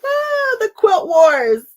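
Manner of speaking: high, squeaky laugh through the words. Real, not acted. Laughing at her family's quilt rivalry, squealing. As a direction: crack up as you say it.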